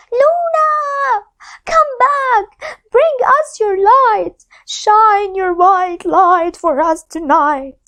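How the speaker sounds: high ringing call to the lost moon. Acted. Birds calling for the lost moon, high cries. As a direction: cry out to the moon, high and long.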